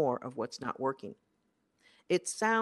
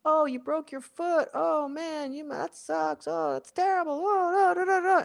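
high, gushing pity, hammed up; mock sympathy; 10 semitones higher than usual. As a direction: coo over the broken foot like an overdone sympathizer. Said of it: "for someone to say".

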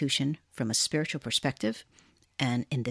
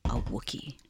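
ordinary talk

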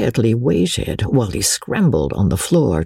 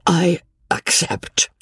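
slow, high, spaced-out words; deliberate; 7 semitones higher, slower than usual. A narrator's reading. Old peddler accepting the bargain after a pause, deliberate. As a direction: weigh each word as you agree. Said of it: "he said deliberately".

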